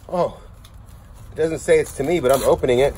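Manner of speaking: ordinary delivery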